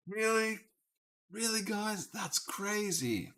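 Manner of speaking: very high, soft put-on incredulity; mock disbelief. Player trying out phrases on the guys, high mock disbelief. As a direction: squeak out a pretend reaction, stretching the last word. Acting out a reaction to guess.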